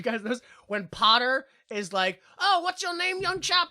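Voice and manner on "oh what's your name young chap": high-pitched posh British caricature; exaggerated British. Potter greets the newcomer, exaggerated British accent. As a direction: overdo a British gentleman's accent. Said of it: "I don't think he's that British".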